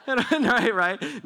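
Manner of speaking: loud high laugh through the words. Real, not acted. Preacher laughing about playing volleyball with the guard. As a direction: let the laugh carry the words.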